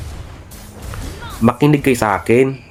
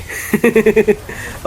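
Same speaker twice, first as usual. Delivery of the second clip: laugh; 9 semitones higher than usual. Real, not acted. Laughs at losing enemy.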